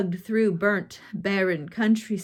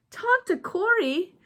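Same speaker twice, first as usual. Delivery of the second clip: high, stunned and incredulous; can't believe who's in front of her; gasping disbelief, voice leaping up; 10 semitones higher, 4 dB louder than usual. A narrator's reading. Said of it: "she asked in disbelief".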